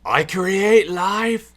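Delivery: slow, high, loud hammed-up villain line; mock villain proclamation. Mocking the villain's big line, hammed-up. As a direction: ham up a grand evil boast.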